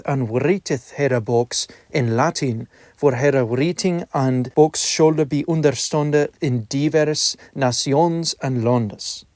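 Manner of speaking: reading in reconstructed Middle English pronunciation; old vowels, 'nations' as nasjɔnz, 'out' as ut. Shown as a demonstration. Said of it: "I shall now begin reading Dialogue Between a Lord and a Clerk".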